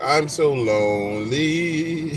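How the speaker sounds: slow, drawn-out whiny moan; hammed-up lonely lament; mock lonely moan. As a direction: stretch it out like a sad sack. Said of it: "Be like".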